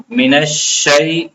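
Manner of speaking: "من الشیء" slow, the shin doubled and pressed. Shown as a demonstration. Teacher showing the stressed shin in "من الشیء". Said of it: "اس کو سختی سے پڑھا جاتا ہے".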